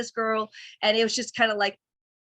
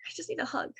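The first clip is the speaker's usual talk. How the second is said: much softer, a small plea after the yelling; 7 semitones higher, 11 dB quieter, faster than usual. Retold or imitated. Asking boyfriend for a hug, softly. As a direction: drop to a hushed little ask.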